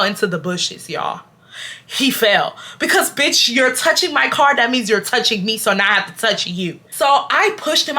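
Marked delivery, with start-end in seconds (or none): none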